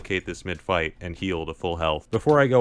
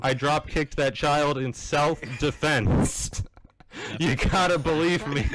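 pleading with cop; 7 semitones higher, slower than usual. Pretend. Pleading with officer after kill.

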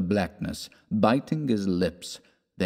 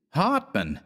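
higher pitched call of the name; shouted name; 7 semitones higher than usual. A narrator's reading. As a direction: blurt out the name, inspired. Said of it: "shouted West".

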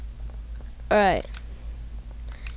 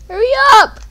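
high, loud yell; urgent shout; 13 semitones higher, 11 dB louder than usual. Real, not acted. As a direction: yell at it to hurry. Racing the storm.

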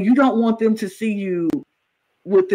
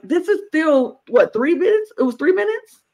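high-pitched incredulous questioning; retold disbelief; 10 semitones higher than usual. Retells own disbelief at time left.

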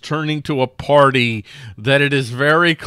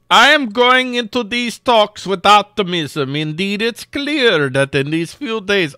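lightly put-on Russian accent, higher and louder; 9 semitones higher, 6 dB louder than usual. Host reading Merkel's quote aloud in a mock Russian accent. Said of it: "why am I doing a Russian accent?".

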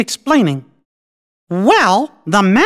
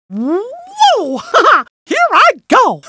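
high, laughing whoop of launching off; excited whoop; 11 semitones higher, much slower than usual. Acted. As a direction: whoop with glee and charge ahead. Character leaps off into action.

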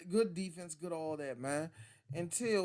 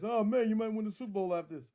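higher, eager teammate voice; naive teammate; 6 semitones higher, 5 dB louder than usual. Retold or imitated. Clueless teammate praises Henne, higher and eager.

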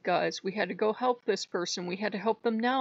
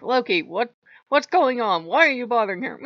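a louder, raised scolding quote; lightly acted telling-off, pitched up; mock scolding; 5 semitones higher, 8 dB louder than usual. Friend scolding Loki for bothering her, mock scolding.

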